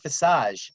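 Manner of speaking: visage said as vi-SAHZH; French-style pronunciation. Shown as a demonstration. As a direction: rhyme visage with massage. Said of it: "Sounds like massage".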